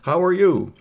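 loud, high device voice; translator gadget greeting. Acted. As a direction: announce a hello as a translating machine. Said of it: "his communicabobulator said".

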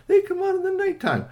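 Rocky correcting Bernie in a high squeak; high insistence. Acted. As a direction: insist that they only come out at night.

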